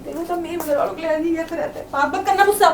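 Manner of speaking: quiet, tearful complaint through crying; hurt and weeping, barely holding it together; soft teary voice, wounded by her husband's words. Real, not acted. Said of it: "तो तुम रो क्यों रही हो?".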